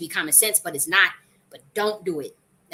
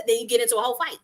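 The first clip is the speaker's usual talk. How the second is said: ordinary talk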